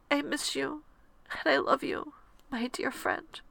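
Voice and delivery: soft, high, voice close to tears; saying goodbye to a dear friend; tearful goodbye. Real, not acted. Farewell to late friend.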